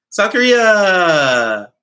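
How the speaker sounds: loud, slow, drawn-out call; excited shout-out. Real, not acted. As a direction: stretch it out and shout it to the fans. Excited shout-out to Korean viewers.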